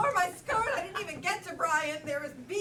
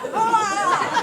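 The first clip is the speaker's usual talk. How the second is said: mock scream; 4 semitones higher, 13 dB louder than usual. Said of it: "you're like".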